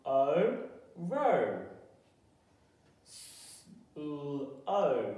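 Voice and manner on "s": just a hiss of breath, no voice; unvoiced S sound. Shown as a demonstration. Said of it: "sound it out".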